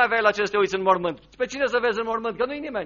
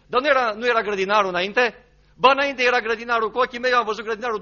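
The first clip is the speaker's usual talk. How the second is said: louder, insistent acted exchange; animated protest; 4 semitones higher, 5 dB louder than usual. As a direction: argue it eagerly, voice lifted. Acted exchange about mistaking the gardener. (replică aprinsă, cu glas ridicat)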